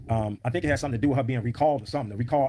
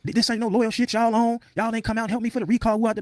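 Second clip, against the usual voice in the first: high-pitched mocking imitation of her tirade; put-on high voice, complaining and confrontational; angry confrontation; 10 semitones higher, 3 dB louder than usual. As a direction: mimic an indignant woman, pitched way up.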